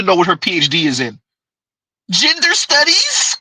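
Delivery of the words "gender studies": mock incredulous. Mocking the woman's degree.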